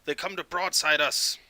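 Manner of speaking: rally the crew as a ship's captain. Acted. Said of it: "you hear Captain Cass".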